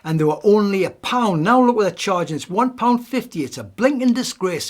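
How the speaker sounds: put-on loud, indignant grumbling old man. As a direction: complain loudly about the price like a fed-up pensioner. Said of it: "And he was saying".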